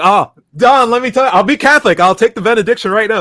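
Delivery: high, loud, hurried pleading; eager convert. Retold or imitated. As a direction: blurt out your surrender fast. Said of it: "It's like".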